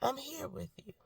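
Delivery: soft high murmur of reassurance; soft voice of God. Retold or imitated. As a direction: say it gently, like a quiet comfort. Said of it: "I see God every day showing me".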